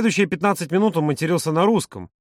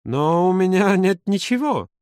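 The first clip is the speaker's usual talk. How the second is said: higher, slow, hesitant protest; unsure mumbling; 5 semitones higher, slower than usual. A narrator's reading. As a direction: stretch it out uncertainly. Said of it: "Юра неуверенно пробормотал". (неуверенно, растягивая слова)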